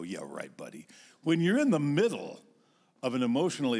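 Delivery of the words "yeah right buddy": low, soft, dry "yeah right"; muttered sarcastic brush-off; sarcastic scoff. Retold or imitated. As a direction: dismiss it under your breath, lower. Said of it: "a part of me that wants to go".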